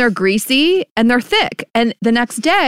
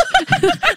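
quick high laugh. Real, not acted. Cohost teasing about her brand, quick high laugh. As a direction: laugh at your own line.

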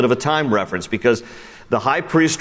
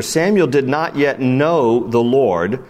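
ordinary talk

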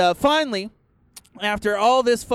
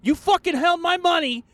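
angry high rant; 12 semitones higher than usual. Acted. Cursing at Walmart.

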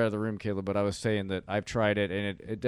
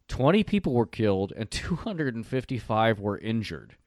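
ordinary talk, nothing marked

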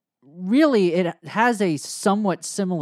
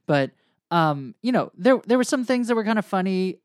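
ordinary talk, nothing marked

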